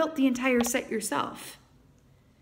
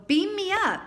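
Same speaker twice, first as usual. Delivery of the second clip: much higher, louder call to the sky; eager call upward; 10 semitones higher, 5 dB louder than usual. Retold or imitated. Audience imagined looking up.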